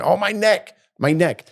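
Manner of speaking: badly faked neck pain; mock injury complaint. Mocking people who sue for nothing.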